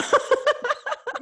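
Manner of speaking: high squealing laugh. Real, not acted. Laughing, embarrassed.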